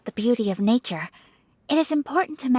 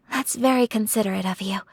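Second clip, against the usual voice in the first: ordinary talk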